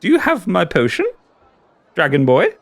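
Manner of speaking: high, giddy creature delight; creepy giggly voice. Acted. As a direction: coo over him like a mad collector. GM in shopkeeper voice.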